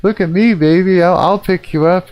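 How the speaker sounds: a high, hammed-up pickup line; lightly voiced high come-on; mock flirty guy. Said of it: "it's like".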